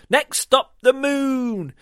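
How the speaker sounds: loud high excited cry; gleeful shout. Acted. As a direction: shout out with flying glee. Goose flying in glee.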